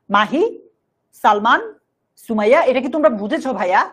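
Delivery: ordinary talk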